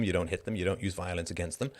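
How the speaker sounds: ordinary delivery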